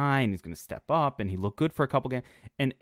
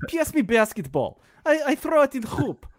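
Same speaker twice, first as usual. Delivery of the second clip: mock European accent; 12 semitones higher than usual. Imitating Porzingis calling to Doncic in a mock European accent. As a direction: ham up a young European teammate. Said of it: "That's a great impression".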